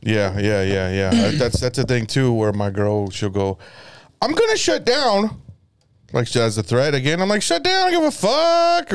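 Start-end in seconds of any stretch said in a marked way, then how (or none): 4.2-5.4 high, slow mock threat; mimicked girlfriend. Girlfriend threatening to withdraw mid-argument, high slow mimicry. 7.4-8.9 very high, hammed-up retort; mock outburst. Boyfriend answering her shut-down threat, high mock outburst.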